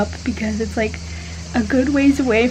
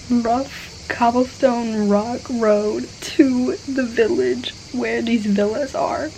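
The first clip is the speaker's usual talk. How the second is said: normal talking voice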